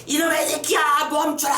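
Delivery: high-pitched commanding yell at an enemy; hero's dramatic cry; acted battle yell. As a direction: yell to halt the attacker, pitch way up. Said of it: "라고 소리를 지르니까".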